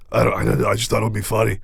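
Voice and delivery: ordinary delivery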